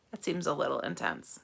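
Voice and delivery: ordinary delivery, nothing marked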